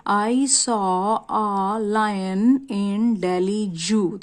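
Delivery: very slow, deliberate, word by word; slow example reading. Shown as a demonstration. Teacher reading the board example to students, very slowly. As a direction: read the example so every word lands. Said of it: "reads example sentence".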